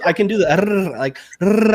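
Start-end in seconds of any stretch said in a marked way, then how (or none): 0.4-1.0 trilled rolling R sound; rolled r. Shown as a demonstration. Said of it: "And I can do". 1.4-1.7 trilled rolling R sound; rolled r. Shown as a demonstration. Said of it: "I can do it".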